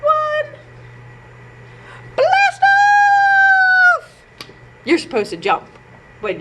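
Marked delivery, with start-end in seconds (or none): 0.0-4.2 high, loud blast off cry; blast off shout. Acted. Counting down to blast off for the kids, high loud shout. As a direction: launch it with a big shout.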